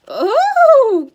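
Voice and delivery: loud, very high, coy "oh"; forced falsetto giggle. A narrator's reading. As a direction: squeal it in a false lady's voice. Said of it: "Grover forced a weak giggle".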